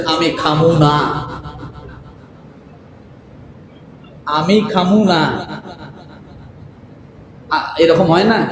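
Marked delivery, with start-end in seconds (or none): 0.1-5.5 mock Dhaka dialect, drawn out; imitating Dhaka slang. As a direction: lightly mimic a son's city slang. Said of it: "মায়ের কাছে যায় বলতেছেন". (ঢাকাইয়া টানে হালকা নকল)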